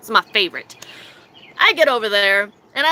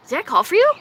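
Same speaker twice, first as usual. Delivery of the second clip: confused question, retold; 6 semitones higher, 3 dB louder, faster than usual. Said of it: "And I go".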